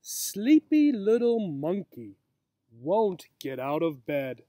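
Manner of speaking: lively sing-song picture-book read; reading to children. A narrator's reading. Teacher reading a picture book to young children outdoors, lively sing-song. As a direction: read it out like story time for little ones.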